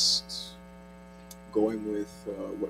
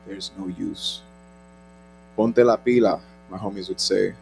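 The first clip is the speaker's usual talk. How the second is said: spoken word poetry; 13 dB louder than usual. Acted. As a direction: perform it like a slam poet. Performs spoken word poem.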